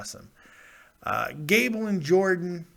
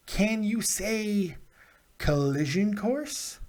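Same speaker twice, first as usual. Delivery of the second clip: slow tease; 4 semitones higher, much slower than usual. Acted. Teasing announcement.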